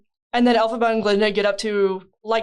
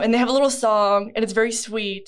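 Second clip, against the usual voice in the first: usual speaking voice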